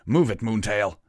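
loud, urgent battle shout; shouted order. A narrator's reading. Captain hurries his unicorn mage in battle, shouting. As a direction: yell it at a lagging soldier. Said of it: "he shouted".

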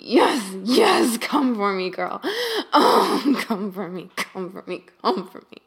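urgent loud urging. Acted. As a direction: drive her on, loud and insistent. Climax urging, building.

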